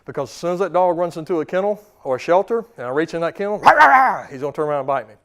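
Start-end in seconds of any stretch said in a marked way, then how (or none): 3.6-4.2 much higher and louder mock growl; a dog snarling at the reaching hand. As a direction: bark it like a cornered dog. Dog lunging in kennel.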